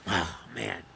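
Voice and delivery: low, soft letdown sigh; disappointed groan. Retold or imitated. Father watching his son's t-ball error, low soft groan. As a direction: drop low and soft, let down. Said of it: "The father's going".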